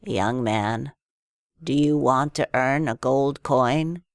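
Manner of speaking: put-on low old man's voice. A narrator's reading. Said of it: "an old man tapped him on the shoulder and said".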